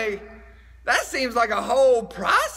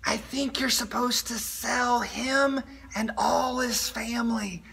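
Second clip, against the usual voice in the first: stage whisper; 4 dB quieter than usual. Acted. Skit: aside whisper.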